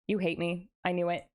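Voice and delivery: normal talking voice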